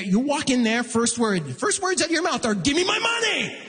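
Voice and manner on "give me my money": high shouted demand; hammed-up angry customer shout; mock demand. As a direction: storm in and demand it. Demanding money at the bank.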